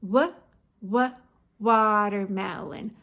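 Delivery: short separate "wuh, wuh", then "watermelon"; sounded-out W. Shown as a demonstration. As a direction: isolate the W before "watermelon". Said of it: "makes the W".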